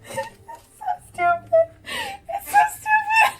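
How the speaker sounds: very high, loud laughing shriek; hysterical laughter. Real, not acted. Streamer losing it over the mask name, hysterical laughing. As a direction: scream it through tears of laughter.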